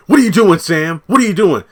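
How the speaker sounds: loud, high mock-outraged shouting; hammed-up protest. Reviewer acts Kyle confronting Sam, loud high protest. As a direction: act out an indignant captive yelling. Acting Kyle's angry protest.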